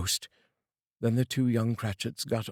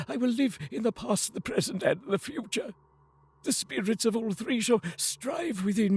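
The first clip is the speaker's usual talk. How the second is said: much higher, earnest pleading promise; fervent vow; 11 semitones higher than usual. Acted. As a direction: swear to change, close to tears. Scrooge's last vow.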